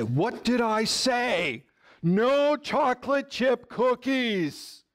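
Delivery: slow, high mock-stern parent; firm parent. Parent telling the begging toddler no, slow mock-stern. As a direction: lay down the law to the toddler, slowly. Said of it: "and you say".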